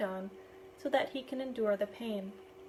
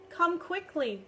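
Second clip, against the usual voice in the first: urgent call, pitched up; 6 semitones higher, slower than usual. A narrator's reading. Boy fetching Richard for the captain, urgent call. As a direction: call him over, hurrying. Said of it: "I call out".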